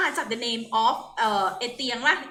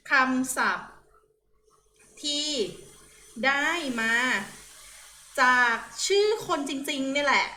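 word by word, pausing for note-taking; dictation. Shown as a demonstration. Teacher dictating a definition for students to write down, spaced-out dictation. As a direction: dictate in short chunks with long pauses. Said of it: "จดลงไปนะคะ".

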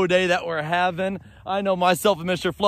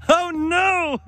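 high-pitched amused dismay; surprised outcry; 9 semitones higher than usual. Real, not acted. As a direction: exclaim at the crash, voice shooting up. Sledder just hit the camera.

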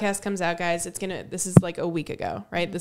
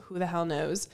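ordinary delivery

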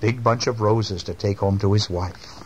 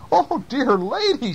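concerned policeman; slower than usual. A narrator's reading. Policeman consoles crying old lady.

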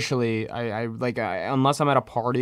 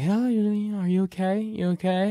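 mimicking mom checking on him, pitched up; worried mom; 8 semitones higher than usual. Mom asking if he is okay.

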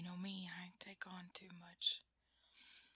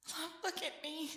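urgent; 7 semitones higher, 5 dB louder than usual. Acted. Urging panicked partner to look.

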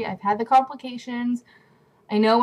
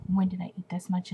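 whisper; 3 semitones lower, 6 dB quieter than usual. Real, not acted. Self-questioning aside.

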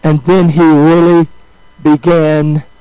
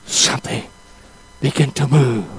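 whispered, much softer, awed; hushed whisper; 6 semitones lower, 7 dB quieter than usual. Acted. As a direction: drop to a breathless whisper of awe. Hushed moment of revival.

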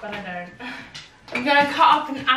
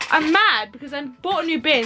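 usual speaking voice

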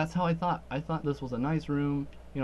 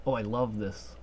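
ordinary talk, nothing marked

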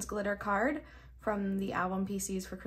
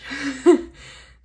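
loud delighted laugh; 4 semitones higher, 15 dB louder than usual. Real, not acted. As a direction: burst of loud giddy laughter. Overjoyed at the photo.

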